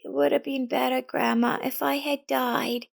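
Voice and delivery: put-on a scared child blaming herself; frightened girl. A narrator's reading. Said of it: "A frightened Elizabeth approached her".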